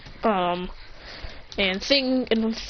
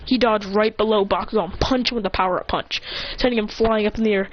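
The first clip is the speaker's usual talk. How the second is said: ordinary delivery, nothing marked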